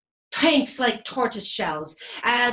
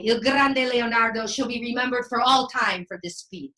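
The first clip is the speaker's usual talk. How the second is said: usual speaking voice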